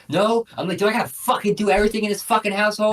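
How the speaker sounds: high-pitched, exasperated mock yell; retold yelling. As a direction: re-enact blowing up at a partner, voice jumping up high. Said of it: "became me just fucking yelling".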